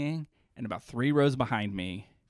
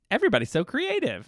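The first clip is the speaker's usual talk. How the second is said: high, louder mimicry of a YouTube woman; YouTube video woman; 10 semitones higher, 5 dB louder than usual. Showing how the chatty Nana sounded, imitated high YouTube voice. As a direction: put on her high-pitched video voice. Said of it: "do you know what she sounded like".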